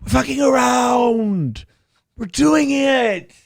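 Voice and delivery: put-on slow, high drunken shouting; blackout drunk. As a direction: yell it slowly like a sloppy drunk. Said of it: "Chad gets hammered and he's like".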